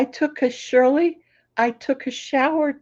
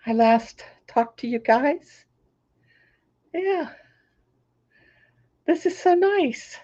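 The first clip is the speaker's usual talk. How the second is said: normal talking voice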